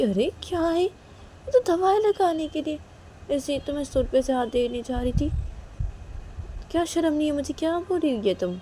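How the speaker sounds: high, defensive and pleading; high protest. Acted. Kajal pleading with Reshma, high and defensive. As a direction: voice her hurt innocence.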